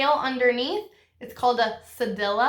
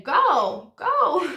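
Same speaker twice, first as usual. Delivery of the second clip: excited cheer, rising higher; 8 semitones higher, 4 dB louder than usual. Shown as a demonstration. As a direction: go go, high and loud like cheering. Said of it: "You're gonna say".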